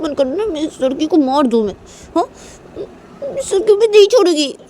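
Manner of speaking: high-pitched indignant complaint; angry, jealous daughter. Acted. Daughter angry at mummy over the other girl, high and whining. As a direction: push the pitch up and accuse like a wronged child.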